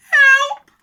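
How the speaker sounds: very high, loud shriek; hammed-up falsetto terror; panicked cry. A narrator's reading. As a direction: shriek for rescue. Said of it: "the other one cried".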